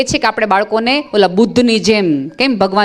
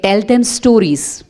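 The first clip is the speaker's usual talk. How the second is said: slow weighty answer; much slower than usual. Retold or imitated.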